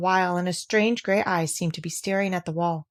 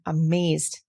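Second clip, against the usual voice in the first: ordinary delivery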